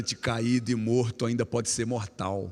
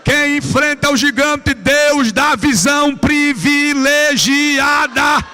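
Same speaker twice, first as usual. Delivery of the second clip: loud, drawn-out high preaching cry; preaching at full force; 13 semitones higher, 16 dB louder, much slower than usual. Acted. Preacher to the youth at the climax, loud slow proclamation. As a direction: hammer the line home slowly.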